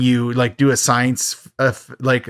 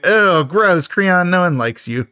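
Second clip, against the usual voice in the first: higher-pitched, playful revulsion; mock disgust; 5 semitones higher, slower than usual. Recapper telling off creepy Creon, mock-disgusted. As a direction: recoil in exaggerated disgust.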